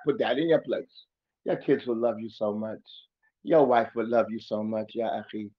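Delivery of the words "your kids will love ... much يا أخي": gentle reassurance. Real, not acted. Gentle encouragement after taunt.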